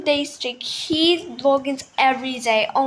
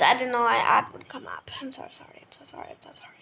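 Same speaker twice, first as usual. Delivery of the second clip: softer and softer whispered sorry; fading whisper; 3 semitones lower, 5 dB quieter, faster than usual. Real, not acted. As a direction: whisper fading almost to nothing. Hushed apology after noisy ad.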